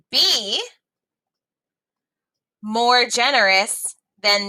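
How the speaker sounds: put-on lively read-aloud for young learners; story reading. A narrator's reading. Teacher reading a story to students, lively read-aloud.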